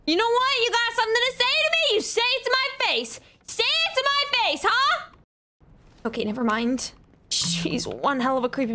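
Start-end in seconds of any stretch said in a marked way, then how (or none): 0.1-5.1 high, fast challenging taunt; mock tough taunt. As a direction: square up to her, half joking. Confronts creepy game girl.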